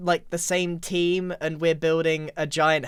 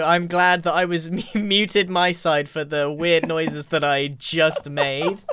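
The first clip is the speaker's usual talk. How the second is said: normal talking voice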